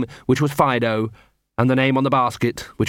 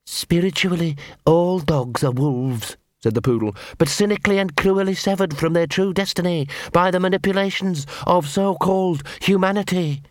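Big Fido's voice; 6 semitones higher than usual. A narrator's reading. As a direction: recite doctrine like a zealot. Narrator voicing Big Fido the poodle.

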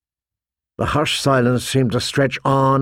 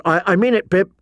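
higher-pitched, halting warning; 6 semitones higher than usual. A narrator's reading. John brandishing his sword at Pip, warning. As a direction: warn him off, stumbling at the start. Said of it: "John warned him".

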